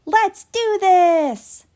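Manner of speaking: loud, slow, high rallying cry; brave cheer. A narrator's reading. Boomer braves the needle, loud slow rallying cry. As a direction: psych yourself up.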